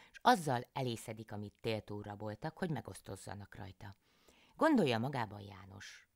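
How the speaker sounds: usual speaking voice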